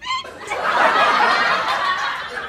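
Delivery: high airy cackle; breathless laugh. Real, not acted. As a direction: wheeze with laughter. Laughing at own joke.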